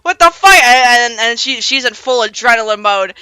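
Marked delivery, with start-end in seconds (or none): 0.0-0.5 high, loud shocked shout; startled yell. Retold or imitated. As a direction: yell it as the roof blows. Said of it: "it's like".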